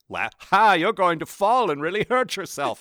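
high, loud gleeful taunt; mocking cartoon character. Podcaster mimicking character who won't help falling woman, mocking. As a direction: call it out high and mocking, big finish on the last word. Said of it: "he's just like".

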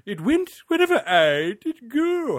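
high, loud, slow attempt at an English accent; hammed-up English accent, vowels stretched. Shown as a demonstration. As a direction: declaim it in a put-on English accent. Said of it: "try an English or an American accent".

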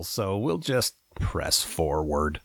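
low drawn-out mutter; low, slow aside. Pretend. Aside in put-on voice.

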